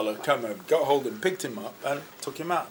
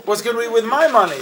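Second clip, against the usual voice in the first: mock panic; 5 semitones higher, 8 dB louder, faster than usual. Mocking own greedy thought.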